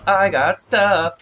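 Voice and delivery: drawn out sing-song; slow singsong. Pretend. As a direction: stretch every word slowly.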